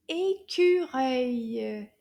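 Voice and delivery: "écureuil" stretched out very slowly, syllable by syllable; slow model word. Shown as a demonstration. Teacher showing kids how to say squirrel, slow. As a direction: draw out "écureuil" slowly for little learners.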